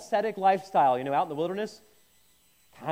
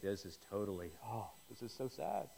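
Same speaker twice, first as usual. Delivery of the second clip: much softer, lower mock-mournful moan; mock gloom; 9 semitones lower, 15 dB quieter than usual. Guest moping at Michael and Jade's wedding, mock mournful.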